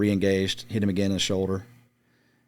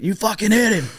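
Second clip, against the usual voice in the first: excited yell; 12 semitones higher, 4 dB louder than usual. Retold or imitated. Spotter shocked by the hit.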